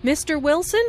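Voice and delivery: "Mister Wilson" rising, to address him; calling his attention with an upward "Wilson"; rising intonation. Shown as a demonstration. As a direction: lift "Wilson" high at the end. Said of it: "Notice the rising intonation on".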